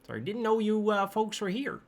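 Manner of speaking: high, badly faked Minnesota voice; attempted Minnesota accent. Patty apologizing to visitors, attempted Minnesota accent. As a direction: have a go at Minnesota, pitched high. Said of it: "can't do a Minnesota accent".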